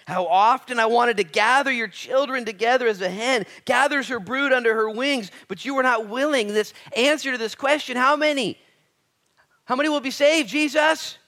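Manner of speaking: loud, high, ringing sermon voice; full-force preaching. Acted. Preacher driving the point home to his congregation, loud and high. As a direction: preach it out to the whole room.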